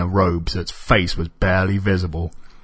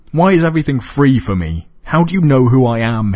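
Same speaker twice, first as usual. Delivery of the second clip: higher, louder puzzled questions; confused; 6 semitones higher, 6 dB louder than usual. Acted. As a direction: press for answers, baffled. Robert confused by free things.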